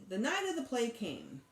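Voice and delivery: ordinary delivery, nothing marked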